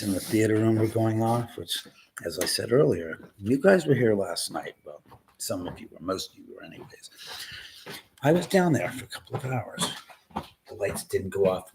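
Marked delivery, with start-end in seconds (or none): 4.9-7.1 hushed, quick whisper; whispered aside. Real, not acted. Aside muttered to viewers.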